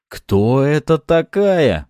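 loud, high, slow roar; roared question. A narrator's reading. Fedya lunges for his gun at the succubus, roared question. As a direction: roar it out, stretching the words. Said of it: "взревел Федя".

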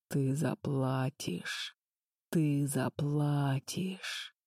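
low, slow, quiet menacing doom; deep sinister voice. A narrator's reading. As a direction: drag it out like a vengeful dark lord. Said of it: "глубокий, зловещий голос". (глубокий зловещий голос)

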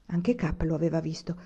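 ordinary delivery

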